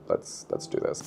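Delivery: soft whisper to herself; hushed whispered resolve. Real, not acted. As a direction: whisper it like a secret pep talk. Psyching up for a big book.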